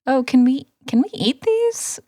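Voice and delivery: high, rising questions; puzzled wondering, pitch climbing on each question. Retold or imitated. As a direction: imitate someone tentatively asking.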